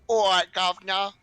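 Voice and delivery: loud, high mock Cockney greeting; bad Cockney impression. Newcomer in chat greeting him in a loud, bad Cockney accent. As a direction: ham up a terrible Cockney accent. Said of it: "a very bad Cockney impression".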